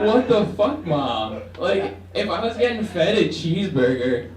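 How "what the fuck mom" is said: shocked at mom, mock indignant; outraged disbelief. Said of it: "I was like".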